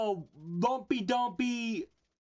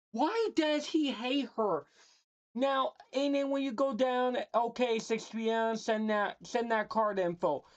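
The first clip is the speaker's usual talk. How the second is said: ordinary talk, nothing marked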